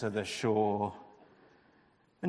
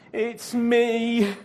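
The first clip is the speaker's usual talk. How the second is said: higher, louder miserable groan; 9 semitones higher, 9 dB louder than usual. A narrator's reading. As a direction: confess with a moan. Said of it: "Jonah groaned".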